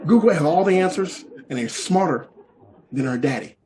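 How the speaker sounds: normal talking voice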